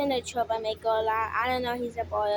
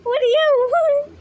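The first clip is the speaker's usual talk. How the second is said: much higher and louder, drawn out; loud high exasperated call; 12 semitones higher, 9 dB louder, slower than usual. Real, not acted. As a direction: yell it at a noisy pet, slowly. Bird demands attention.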